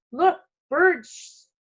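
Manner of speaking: put-on thrilled boy calls out; excited shout. A narrator's reading. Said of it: "shouted Jamie".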